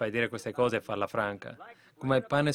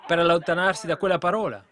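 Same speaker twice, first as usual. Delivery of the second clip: high, loud thundering sermon; full-force preaching; 4 semitones higher, 5 dB louder than usual. Real, not acted. Preacher warning of death, loud and high. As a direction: thunder it at the congregation.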